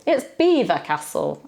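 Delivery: "Beaver" said like the animal, BEE-ver; correct pronunciation. Shown as a demonstration. Teacher gives the real name of Belvoir. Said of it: "as in the animal".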